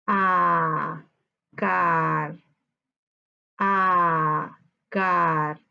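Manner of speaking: "A" and "car" each held low and level; drawn-out low, unaccented "A" and "car"; unstressed syllables. Shown as a demonstration. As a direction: say "A", "car" apart, with no lift on either. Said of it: "Esas son sílabas átonas".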